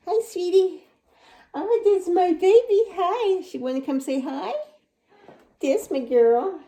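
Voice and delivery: high, cooing pet voice. Real, not acted. Cooing to her cat Whiskey.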